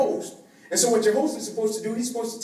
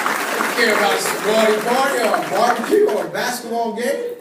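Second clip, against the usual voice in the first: gleeful shout; 7 dB louder than usual. Real, not acted. Gleeful about his own bed.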